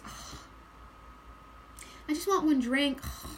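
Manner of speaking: put-upon complaints broken by heavy groans; exasperated. Acted. Reader voicing Aquarian as everyone's helper, exasperated. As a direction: gripe like everyone's overworked helper, sighing between complaints. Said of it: "That's the energy I'm getting".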